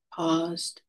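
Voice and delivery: normal talking voice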